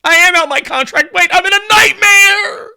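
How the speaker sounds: loud, high mock crying shout; hammed-up panicked wail. Voicing an actor stuck in his contract, hammed-up wailing panic. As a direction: wail it like a comic meltdown. Acted plea escalates to wail.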